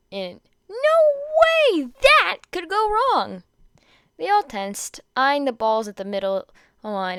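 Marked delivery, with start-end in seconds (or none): 0.1-3.4 high, slow mock-innocent sarcasm; sarcastic. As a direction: drag it out as if nothing could go wrong. Sarcastic aside after bad rules.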